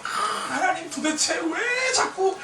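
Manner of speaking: loud, high frustrated lament; complaining to God. Retold or imitated. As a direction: cry out to God in exasperation. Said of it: "제가 막".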